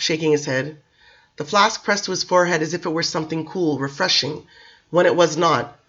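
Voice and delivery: ordinary delivery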